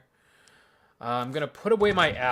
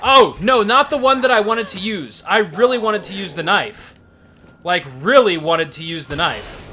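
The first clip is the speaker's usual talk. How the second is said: mock despair; 6 semitones higher, 9 dB louder than usual. Mock wailing at game loss.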